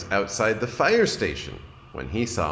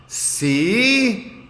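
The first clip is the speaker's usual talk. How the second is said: put-on high, loud little-boy voice; a child showing his point; higher, louder kid voice; young Franklin, rueful; 14 semitones higher, 5 dB louder than usual. A narrator's reading.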